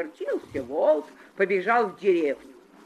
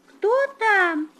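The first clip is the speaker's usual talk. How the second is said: high little goats asking; child calling out, high; 14 semitones higher, 5 dB louder than usual. Acted. Kids call through the door.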